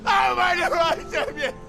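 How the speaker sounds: very high, anguished shout; frantic scream. Acted. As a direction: scream at him to wake. Begging dying brother to wake.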